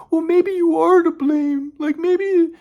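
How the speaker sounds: slow, very high mock falsetto; drawn-out put-on voice of a blaming girlfriend; imitating girlfriend. Girlfriend putting the blame on him, in slow mocking falsetto. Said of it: "I remember she said".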